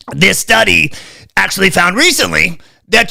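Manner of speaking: lampoon the health official's patient lecture; Fauci impersonation. Retold or imitated. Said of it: "I just had to do the Fauci impersonation".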